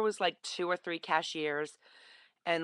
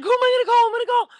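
much higher and louder, squealing with joy; gleeful holler; 11 dB louder, much faster than usual. Retold or imitated. Walking out after a zero total, hollering with glee. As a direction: shout it out like you just won.

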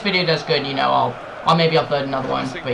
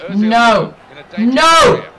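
each no higher and louder; drawn-out rising panic; mounting dismay; 6 semitones higher, 8 dB louder than usual. Real, not acted. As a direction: climb from worry to a cry. Danger near his goal.

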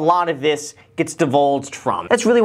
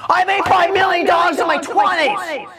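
bragging guru impression; 13 semitones higher than usual. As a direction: brag it at the top of your voice. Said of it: "He's definitely very proud of his accomplishments".

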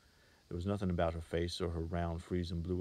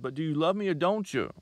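higher and louder whining question; whining plea; 10 semitones higher, 8 dB louder than usual. Acted. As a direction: whine it up at her, insisting. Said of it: "he almost whined".

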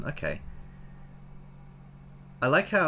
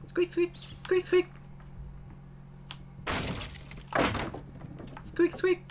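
tiny high-pitched mouse squeaks; mouse squeaking; 6 dB quieter, slower than usual. Pretend. Voices the mouse on screen.

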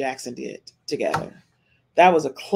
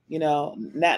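normal talking voice

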